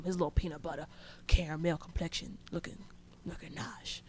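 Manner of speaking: breathy secret compliment, barely voiced; whispered aside. Real, not acted. Admiring a cute classmate, whispered aside. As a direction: whisper it under your breath.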